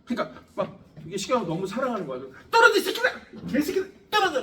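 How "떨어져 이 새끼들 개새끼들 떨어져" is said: high, loud hammed-up shouting; the strong force screaming at the nucleons, hammed-up high yell; mock yell. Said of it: "이러면서 에너지 쓴단 말이야".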